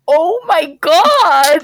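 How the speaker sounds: slow, loud, high gasp of shock. Retold or imitated. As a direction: drag it out in disbelief. Reacting to friend's cruel remark.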